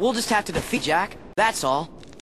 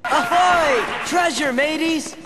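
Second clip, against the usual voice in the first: higher, louder playful pirate shout; excited pirate call; 10 semitones higher, 4 dB louder than usual. Acted. Playing pirate, calling out.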